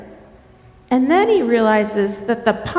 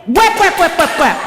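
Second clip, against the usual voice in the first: higher, louder rhythmic whaps; beaver tail slapping; 5 semitones higher, 4 dB louder than usual. Acted. Storyteller demonstrates Beaver's tail for listeners, imitated slapping. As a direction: smack out each whap like a paddle. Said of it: "what sound does Beaver's tail make?".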